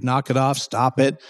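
usual speaking voice

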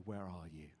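very low, soft, intimate question; God's whisper. Acted. God whispers to each worshipper today, very softly. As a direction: ask it hushed, as if into their thoughts. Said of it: "as God whispers to you today".

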